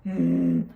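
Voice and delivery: loud strained hum through closed lips; muffled push grunt, breath held. Retold or imitated. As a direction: push hard without opening your mouth. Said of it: "not being able to go".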